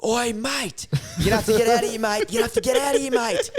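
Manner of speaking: high-pitched imitation of calling out; mock warning yell. Yelling to an old dude in a tinny, mock warning. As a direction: call across the water in a high voice. Retold old boatman being yelled at.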